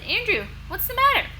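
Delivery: high concerned friend voice; friend's voice. Acted. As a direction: ask like a worried kid.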